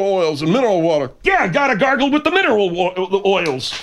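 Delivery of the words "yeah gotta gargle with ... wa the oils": mocking Margaret. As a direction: mimic her nagging advice.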